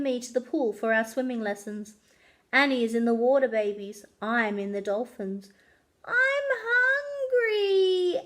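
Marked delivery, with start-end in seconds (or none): 6.1-8.2 put-on slow, high, drawn-out child's whine near tears; whining. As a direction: whine it like a hungry little kid. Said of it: "Annie whines".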